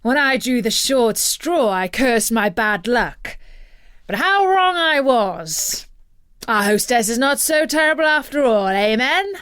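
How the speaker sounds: a cheerful boast to his comrades; Eurylochus speaking. A narrator's reading. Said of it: "Eurylochus said".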